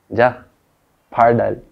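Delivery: loud, punchy urging; motivating brother. Retold or imitated. Brother urging his shy younger sibling on, loud motivating push. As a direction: egg him on with a loud burst. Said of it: "भैया मुझे हमेशा motivate करते थे".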